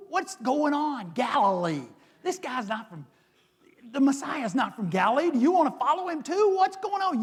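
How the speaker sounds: high, mocking indignant priests. Priests rounding on Nicodemus, high and indignant. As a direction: ridicule him as a traitor. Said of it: "Pharisees and chief priests are like".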